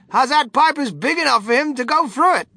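high, loud bewildered voice; flustered father character; puzzled father. A narrator's reading. Mister Gloop's voice in story.

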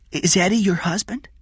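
put-on high-pitched child's question; curious child. Jeff asks about Eddie, curious child. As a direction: ask in a high little voice.

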